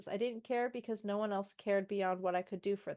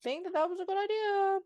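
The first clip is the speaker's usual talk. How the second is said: rising, exasperated disbelief, much higher at the end; incredulous; 6 semitones higher than usual. Real, not acted. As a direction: ask it like you can't believe it. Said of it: "incredulous rant at character".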